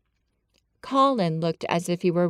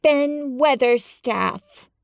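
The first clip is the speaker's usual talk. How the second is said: slow, drawn-out call upward; 6 semitones higher, 3 dB louder, much slower than usual. A narrator's reading. Mary calls up to Ben, slow and gasping. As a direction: catch her breath and call. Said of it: "called out Mary, finding her breath".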